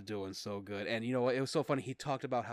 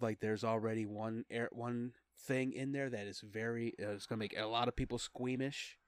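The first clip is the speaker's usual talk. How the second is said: normal talking voice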